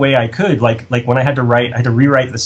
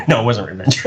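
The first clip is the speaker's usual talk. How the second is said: usual speaking voice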